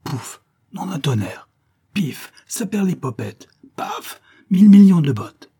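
from muttered oaths up to a loud high curse; comic rage swelling to a yell; building swearing fit. Acted. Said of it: "éclatèrent ... le chapelet des principaux blasphèmes".

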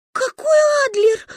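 очень высокий изумлённый выкрик; прервав рыдания; сквозь плач. Acted. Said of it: "прервала свой вселенский плач". (громко, изумлённо)

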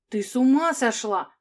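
loud shriek. Acted. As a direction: shriek it, beside yourself. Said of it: "не своим голосом взвизгнула".

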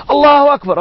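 excited takbir. Retold or imitated. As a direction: burst out in joyful praise. Listeners shout takbir.

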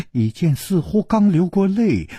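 usual speaking voice

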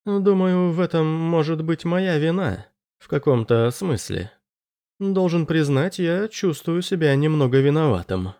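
high-pitched, hesitant character voice; apologetic Anders. Acted. Anders apologizing to the dying sergeant, sheepish and high-pitched.

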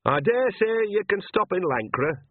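high, drawn-out call above the noise; over the din. A narrator's reading. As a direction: pitch it up to carry over a din. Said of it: "said Nanny above the din".

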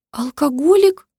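high, squeaky shocked question; squeaking. A narrator's reading. Mother squeaks her next guess, high and shocked. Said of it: "пропищала она".

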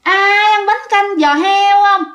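high, drawn-out sing-song hawker's call; shrill female street-vendor cry. A narrator's reading. Ghostly vendor calling out pork-knuckle noodle soup at night, high sing-song cry. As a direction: chant it slowly like a woman selling from a shoulder pole. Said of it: "giọng lanh lảnh của một người phụ nữ".

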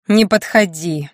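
slow, emphatic warning; warning cry. A narrator's reading. Wife warns approaching husband to keep back, slow warning. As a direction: ward him off, drawing the words out.

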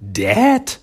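"Dad?" jumped high and loud; shocked disbelief on "Dad?"; surprised Dad?. Retold or imitated. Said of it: "Donnie says this thing".

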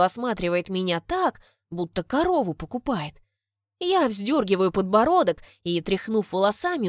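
ordinary delivery

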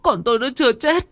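frantic screaming wail. A narrator's reading. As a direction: scream hysterically for them to open it up. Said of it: "Bà Hướng lại càng gào tợn hơn".

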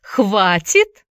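sly echo, rising at the end; creepy, questioning repeat; insinuating question. A narrator's reading. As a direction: query the word back with silky menace. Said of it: "вкрадчиво переспросил Эллиот".

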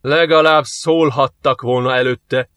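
slow, drawn-out pained moan; wailing complaint. A narrator's reading. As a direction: groan the words over a burnt glove. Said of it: "jajdult fel Joff".

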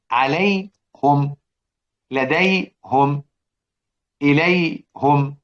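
عليهم، لديهم، إليهم read slowly with damma on the ه: alayhum, ladayhum, ilayhum; slow model reading with -hum endings; Hamza's reading. Shown as a demonstration. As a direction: draw out each word ending in -hum. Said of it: "سيدنا حمزة قال إيه؟".

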